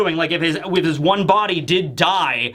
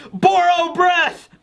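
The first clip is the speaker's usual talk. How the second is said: put-on high, slow strained yell of a boy forcing a power; strained kid dragon roar; straining dragon shout; 11 semitones higher, much slower than usual. Momonosuke straining to use power.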